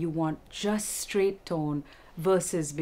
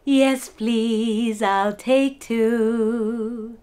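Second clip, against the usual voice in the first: smiling, sung very slowly and louder; 3 semitones higher, 6 dB louder, much slower than usual. Shown as a demonstration. Teacher singing a polite order through a smile. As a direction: let the smile brighten every word. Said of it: "with a smile".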